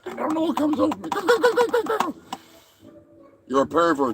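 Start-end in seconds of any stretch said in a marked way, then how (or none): 0.0-2.2 squeaky high puppet squawk; high squeaky turkey puppet. Acted. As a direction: screech it like a frantic little turkey.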